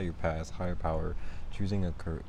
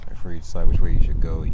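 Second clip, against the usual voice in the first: louder, amped-up repeat; 10 dB louder than usual. Shown as a demonstration. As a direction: say it again with energy.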